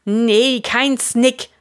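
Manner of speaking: calling out. A narrator's reading. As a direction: call out a correction. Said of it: "de Fritz Raupen". (luud ropen)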